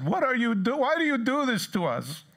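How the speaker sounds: high-pitched mock parental reproach; upset parents. Parents' exasperated question in retold story.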